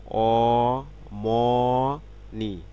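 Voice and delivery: অমনি stretched out very slowly, each syllable held; slow model word. Shown as a demonstration. Teacher models অমনি slowly for learners. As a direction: draw out অমনি slowly for the class. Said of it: "teacher models Korean word".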